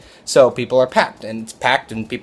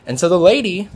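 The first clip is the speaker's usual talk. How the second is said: normal talking voice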